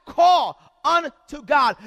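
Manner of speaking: "Call unto God" belted out, drawn slow; slow shouted call; loud slow refrain. Acted. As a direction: hammer the refrain home. Refrain in preached list.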